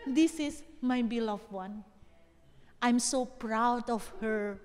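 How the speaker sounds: a father's quiet pride, very slow; tender God. Pretend. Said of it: "Tuhan itu akan bilang". (suara Tuhan yang lembut, bangga dan sangat pelan)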